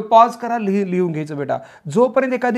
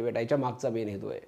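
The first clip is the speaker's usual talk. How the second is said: ordinary talk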